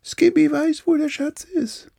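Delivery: very high little monkey voice, called out; monkey calling from afar. Acted. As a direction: shout it from far away like a monkey. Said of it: "rief von weit hinten der Affe". (hohe Affenstimme, von weitem gerufen)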